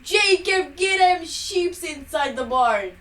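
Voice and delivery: loud, high, slow hammed-up Southern farmer calling; bad Southern accent. Friend loudly calls Jacob in a fake Southern accent. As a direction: holler across the farmyard in a fake drawl. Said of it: "That was so wrong".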